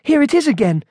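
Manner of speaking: high-pitched appalled exclamation; horrified panic. A narrator's reading. Monk back among the supermarket sights, horrified.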